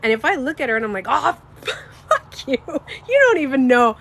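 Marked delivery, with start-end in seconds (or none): 1.1-4.0 loud, higher curse broken by laughter; laughing, hammed-up mock insult; joking swearing. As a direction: swear at your best friend as a joke. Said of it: "She'll start to laugh".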